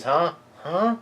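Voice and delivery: two rising high questions; baffled child, climbing in pitch; acted bafflement. Lillian baffled by empty house.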